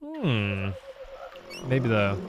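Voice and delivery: usual speaking voice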